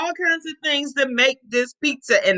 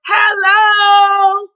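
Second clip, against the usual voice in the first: calling out; 4 semitones higher, slower than usual. Acted. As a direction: sing it out to the whole street. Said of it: "She stuck her head out the window".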